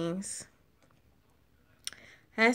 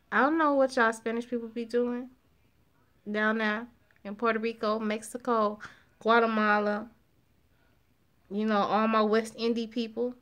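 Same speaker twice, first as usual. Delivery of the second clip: normal talking voice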